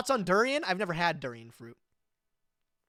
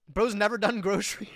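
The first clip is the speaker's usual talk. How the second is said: normal talking voice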